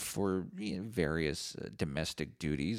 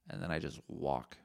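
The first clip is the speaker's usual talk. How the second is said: ordinary delivery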